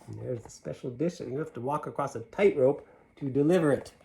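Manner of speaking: usual speaking voice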